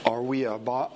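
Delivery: ordinary talk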